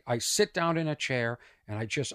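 normal talking voice

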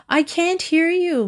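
high-pitched yell without much volume; mild narrated yell. A narrator's reading. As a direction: call it high, as if unheard. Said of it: "Mary yelled".

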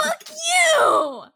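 high, loud laughing shout; outraged shout. Real, not acted. Host cursing Gideon, outraged shout. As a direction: yell it out at the character.

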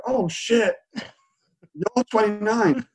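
high, slow mock shock; the barber dismayed at the age, voice up high. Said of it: "Or, like".

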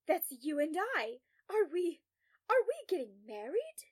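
loud, high, giddy swoop; giddy excitement. A narrator's reading. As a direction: burst out with thrilled astonishment. Patient thrilled, thinks they're marrying.

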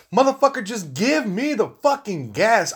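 fed up with the pump, let it rip; exasperated outburst. Real, not acted. Podcaster ranting at a gas pump, fed-up outburst.

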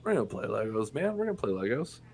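stoned half-asleep reassurance; spaced-out dad. Retold or imitated. High dad mumbling promises to his son, drowsy. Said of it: "And he was like".

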